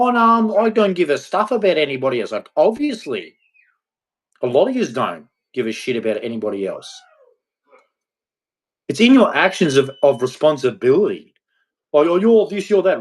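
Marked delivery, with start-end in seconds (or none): 0.0-2.3 mocking selfish voice. To selfish adults, a high mocking sneer. 11.9-13.0 high, louder accusing mimic; mocking accusation. To women who judge him, a high mocking accusation.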